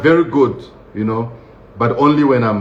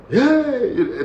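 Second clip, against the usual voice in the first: mock wardens' yell; 12 semitones higher than usual. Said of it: "they start screaming".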